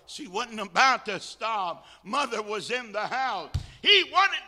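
building from loud insistence to a high full shout; impassioned preaching. Real, not acted. Preacher telling of a daughter at the fire, impassioned shout.

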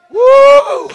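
high revival "woo"; excited whoop. Real, not acted. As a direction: whoop it up mid-sermon.

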